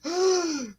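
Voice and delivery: high shocked gasp; imitating a stunned gasp; mock gasp. Reaction to note.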